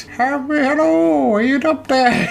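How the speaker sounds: high Irish voice calling up a well; an Irish man calling for help from a well, pitched high; little Irishman calling. Retold or imitated. Podcaster imitates trapped man as a little Irishman, high Irish call. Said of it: "He's a little Irish man".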